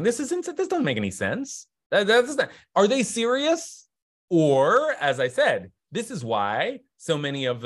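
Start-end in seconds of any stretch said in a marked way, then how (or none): 0.0-3.9 mock disbelief. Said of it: "you should be thinking".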